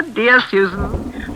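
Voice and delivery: drawn-out, slow, menacing endearment; sinister toast. Acted. The hostess toasting Susan with slow, creeping menace. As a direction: stretch the name like a threat.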